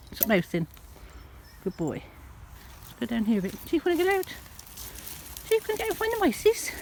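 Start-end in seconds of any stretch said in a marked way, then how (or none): 3.7-6.7 very high sing-song pet talk; coaxing the cat. Real, not acted. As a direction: coax the cat out in a high coo.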